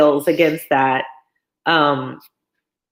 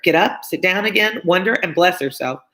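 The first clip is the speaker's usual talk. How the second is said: ordinary delivery, nothing marked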